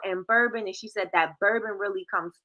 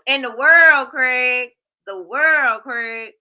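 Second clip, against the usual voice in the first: loud hype; 3 semitones higher, 8 dB louder, much slower than usual. Real, not acted. Hypes soaps to a viewer.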